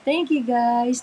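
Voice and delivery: normal talking voice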